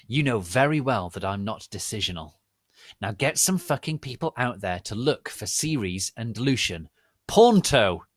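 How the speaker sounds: loud commanding demand; angry order. Acted. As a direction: order them about. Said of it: "angry demand".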